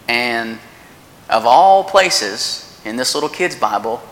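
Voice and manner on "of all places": of all places stretched slow and louder; slowly punched of all places; slow emphasis. Real, not acted. Stressing the surprising coincidence.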